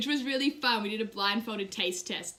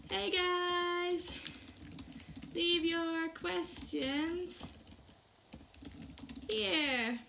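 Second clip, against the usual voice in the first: high, slow typing-along voice; slow dictating voice; 6 semitones higher, 4 dB quieter, much slower than usual. Real, not acted. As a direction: drawl it out while typing. Speaking a chat comment while typing.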